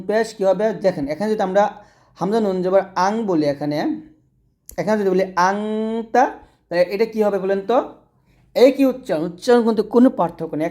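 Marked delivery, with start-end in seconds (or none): 5.5-6.2 আংতা with a long nasal hum on ং; ghunna. Shown as a demonstration. Teacher comparing examples, আংতা with held nasal ghunna. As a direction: hold the ং of আংতা in the nose. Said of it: "অনুস্বর দিয়ে উচ্চারণটা করবো".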